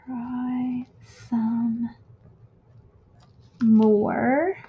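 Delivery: word by word with long pauses; drawn out while writing. Real, not acted. Crafter talking to herself while lettering a quote, drawn out. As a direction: say each word slowly as you write it.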